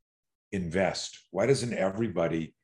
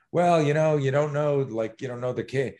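higher-pitched, stalling protest; defensive excuse; 5 semitones higher, 4 dB louder than usual. Acted. Bystander asked why he didn't act, defensively excusing himself. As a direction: make excuses under questioning.

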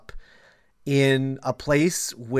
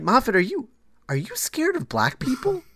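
incredulous; 8 semitones higher than usual. Retold or imitated. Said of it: "you're just like".

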